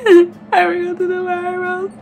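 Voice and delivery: high wailing mock sob; fake crying. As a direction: wail the line like fake crying. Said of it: "Stop crying".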